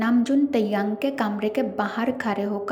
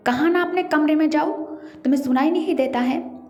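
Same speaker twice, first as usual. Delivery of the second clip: angry shout; 5 semitones higher, faster than usual. A narrator's reading. Older brother shouting at Jimin to go to his room, fast angry yell. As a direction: bark the order, furious and rushed. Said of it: "चिल्लाकर कहता है".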